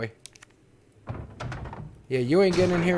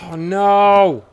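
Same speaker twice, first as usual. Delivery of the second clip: dismay; 9 dB louder than usual. Real, not acted. Dismay at a threat in game.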